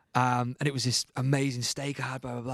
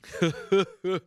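a put-on chuckle, higher than usual; mock Dallas laugh; 6 semitones higher, 3 dB louder than usual. Retelling the producer's invite, imitating his laugh. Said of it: "his laugh like this".

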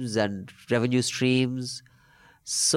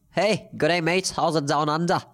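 playful Aussie greeting, higher pitch; Australian accent; 5 semitones higher than usual. Pretend. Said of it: "the rest in Australian accent?".

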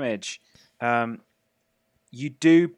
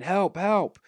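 higher-pitched mock cry for help; distant shouts for help; 8 semitones higher than usual. A narrator's reading. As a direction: voice a restrained call for help. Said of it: "Alice and Dade shouting".

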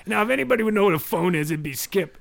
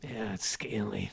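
soft secretive whisper; 8 semitones lower, 13 dB quieter than usual. Acted. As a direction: whisper it close and low. Unvoiced whisper.

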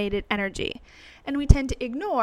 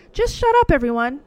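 fed-up outburst; 4 semitones higher, 5 dB louder, faster than usual. Real, not acted. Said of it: "annoyed at crowd noise".

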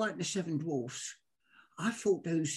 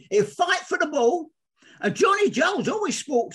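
usual speaking voice

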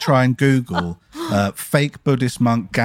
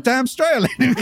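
laughing boast; 11 semitones higher than usual. Retold or imitated.

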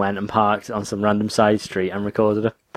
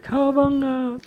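slow high Cowabunga shout; much slower than usual. Shown as a demonstration. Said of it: "you do the Cowabunga".

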